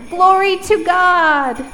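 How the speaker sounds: loud, high, slow call; really loud. Shown as a demonstration. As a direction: proclaim it. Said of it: "repeat after me".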